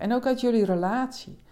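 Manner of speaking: usual speaking voice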